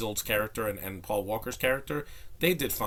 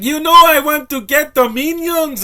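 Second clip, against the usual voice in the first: much higher and louder, a shrill mock of an exaggerated Spanish accent; 17 dB louder than usual. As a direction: parody the movie's over-the-top Mexican character voice, hammed way up. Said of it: "You don't know how Spanish people sound".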